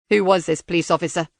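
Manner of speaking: cold robotic interrogator. Acted.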